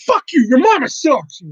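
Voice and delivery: high, loud snapping comeback; angry retort. Pretend. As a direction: shout it back at the troll. Imagined comeback to trolls.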